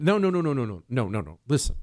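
ordinary delivery

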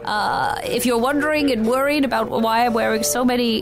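high, sing-out crone sales pitch; theatrical bakery commercial, stretched high greetings; improvised ad read. Acted. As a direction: hawk the baked goods to the crowd.

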